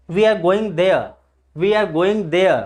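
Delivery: "there" as de-a without r; silent r. Shown as a demonstration. Teacher modelling example sentences, "there" with silent r. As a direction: end each "there" on a falling schwa. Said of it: "आर के जगह पे replace अ".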